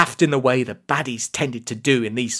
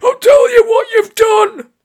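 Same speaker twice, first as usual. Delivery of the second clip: very high, very loud scolding; furious bellow; 12 dB louder than usual. A narrator's reading. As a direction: bellow like an irate mother. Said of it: "a less than soothing voice bellowed".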